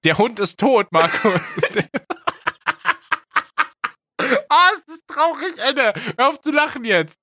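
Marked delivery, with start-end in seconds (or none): 1.5-3.9 high burst of laughter. Real, not acted. Sad line sets off laughter.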